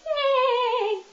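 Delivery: over-the-top cheerful cheer; peppy yay. Shown as a demonstration. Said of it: "all this peppiness".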